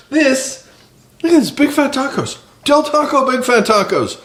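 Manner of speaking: high, hammed-up salesman pitch; used car salesman voice. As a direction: sell the tacos like a car-lot huckster. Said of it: "used car salesman voice".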